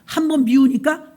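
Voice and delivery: ordinary talk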